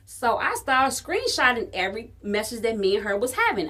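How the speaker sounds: ordinary talk, nothing marked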